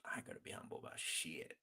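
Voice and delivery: whispered, quick repeat of a boast; hushed and muttered aside; under the breath. Real, not acted. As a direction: whisper it fast, as if to yourself.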